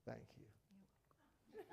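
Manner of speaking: soft whispered heartfelt thank you; tender, deliberate whispered thanks; sincere whisper. Shown as a demonstration. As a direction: look them in the eye and breathe it. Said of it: "look right at them and say".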